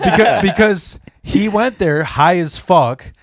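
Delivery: can barely hold back laughter; telling the story while cracking up; laughing. Real, not acted.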